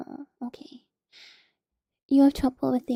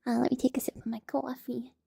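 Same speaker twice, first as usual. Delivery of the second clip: normal talking voice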